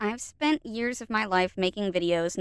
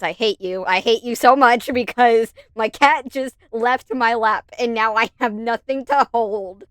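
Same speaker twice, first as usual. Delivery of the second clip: mock hatred; 3 semitones higher, 6 dB louder than usual. Mock fury at the editor.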